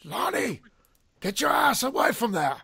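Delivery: a half-hearted stab at a stern doctor's warning; lightly voiced Loomis, barely off his own voice; horrible Loomis impersonation. Podcast host reads a famous horror quote, weak impersonation. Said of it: "That was a horrible impersonation".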